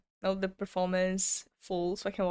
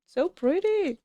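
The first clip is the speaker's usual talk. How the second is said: squeal; 10 semitones higher, 5 dB louder, slower than usual. Real, not acted. Sees second photo card.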